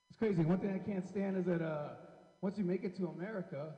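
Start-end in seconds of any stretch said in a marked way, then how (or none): none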